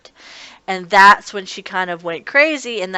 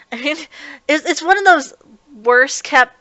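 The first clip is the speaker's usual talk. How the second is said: normal talking voice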